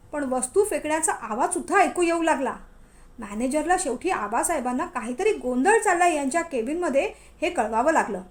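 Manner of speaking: ordinary delivery, nothing marked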